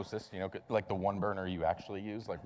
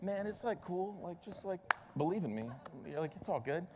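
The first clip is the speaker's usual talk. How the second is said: lightly voiced laid-back hippie, higher and softer; hippie Jesus; 7 semitones higher, 6 dB quieter than usual. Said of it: "hippie Jesus that's just like".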